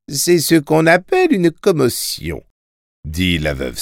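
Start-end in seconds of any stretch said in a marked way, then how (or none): 0.1-2.4 put-on higher, knowing old schoolmistress; old widow voice. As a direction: explain it like a calm old widow. (voix de vieille veuve, posée)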